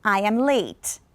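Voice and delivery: "late" falls, admitting it; falling statement. Shown as a demonstration. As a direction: state it firmly, drop "late". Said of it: "The normal one would be".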